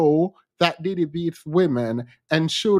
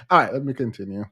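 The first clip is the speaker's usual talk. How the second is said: ordinary delivery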